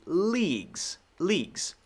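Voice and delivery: Leagues with a clear long ee, falling pitch. Shown as a demonstration. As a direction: draw out the ee in Leagues. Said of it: "Long E".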